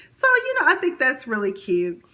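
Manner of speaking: usual speaking voice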